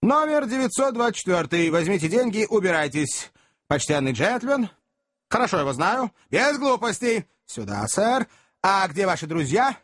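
brisk string of short phrases; nonstop chatter. A narrator's reading. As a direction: chatter on, switching from one listener to another. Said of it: "не переставая болтать". (болтая без остановки)

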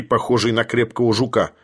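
ordinary delivery, nothing marked

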